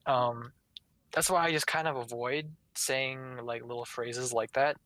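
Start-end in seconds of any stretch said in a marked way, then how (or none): none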